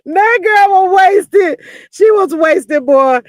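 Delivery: high, loud, slow, laughing through it; loud, high laughing shout. Real, not acted. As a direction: crack up while yelling it out. Laughing about drunk guest.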